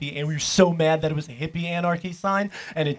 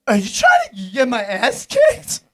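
put-on loud, slow, higher-pitched drunk protest; imitated drunk indignation; 5 semitones higher, 10 dB louder than usual. Scrawny drunk questioning his so-called backup, loud mock indignation. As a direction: drag it out as a hammed-up drunk complaint. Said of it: "He's all drunk".

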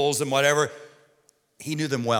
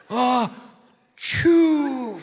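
big hammed-up "Oh, chew" sneeze, higher and louder; mock sneeze; 6 semitones higher, 4 dB louder than usual. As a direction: blast out a comic sneeze. Acting out wife's sneeze.

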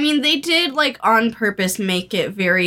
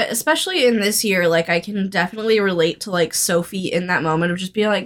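ordinary talk, nothing marked

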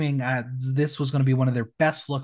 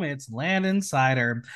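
ordinary talk

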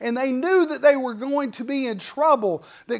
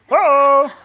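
loud, higher-pitched playful whoop; mock wrestler holler; 4 semitones higher, 12 dB louder than usual. Acting out Dusty Rhodes, wrestling memory.

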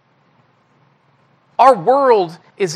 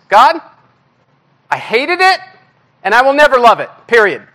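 high, loud, defiant complaint; stubborn protest to God; 5 semitones higher, 4 dB louder than usual. Retold or imitated. Pastor venting at God, loud stubborn protest. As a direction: throw it at God like a sulking child. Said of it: "that's what I wanna tell God".

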